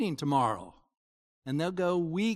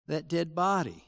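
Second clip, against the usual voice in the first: ordinary talk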